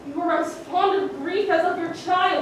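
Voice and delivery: normal talking voice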